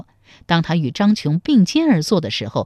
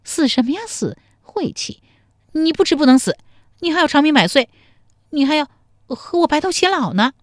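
high, loud coy scolding; high-pitched woman's fond reproach; affectionate chiding; 9 semitones higher, 3 dB louder than usual. A narrator's reading. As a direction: chide him like a sweetheart. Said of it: "嗔道".

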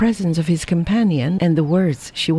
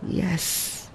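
breathless whispered yes, much softer; murmured; 10 dB quieter than usual. A narrator's reading. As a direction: barely voice it, crushed. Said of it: "murmured Lin Fo".

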